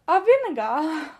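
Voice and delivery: imitated British accent. Colleagues echoing her word in a mock British accent. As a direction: stretch "vinegar" like a British colleague, no final r. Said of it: "they'll go like".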